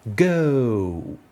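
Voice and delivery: "go" stretched as an English diphthong from e to o; "go" with a slow English vowel glide. Shown as a demonstration. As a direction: sweep the vowel of "go" from e to o. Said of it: "tout le balayage de son entre ces deux voyelles".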